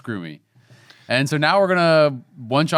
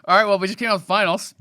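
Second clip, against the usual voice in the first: ordinary delivery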